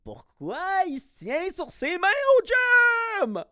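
very high, loud onlooker's cry; exaggerated high-pitched holler of disbelief; mock bewildered shout. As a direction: yell it like a scandalized bystander, hammed up. Acting out gawking onlookers at the gym.

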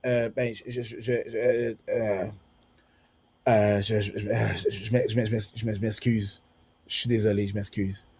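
low, soft, badly stuttered apology; nervous stammer. Boy apologizing to the girl he bumped into, stammering nervously. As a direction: stumble and restart on nearly every word, voice dropped. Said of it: "je bégaye gros comme le bras".

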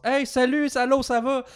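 high-pitched mock tourist girls' greeting; imitated high, excited hello. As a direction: pitch it way up, imitating eager new acquaintances. Imitates excited Québécoises greeting them.

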